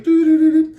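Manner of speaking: high sung beeps of a police radio. Retold or imitated. Father imitating the officer's radio keying up, high mock beeps.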